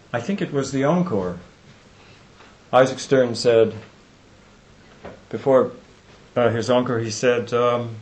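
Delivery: normal talking voice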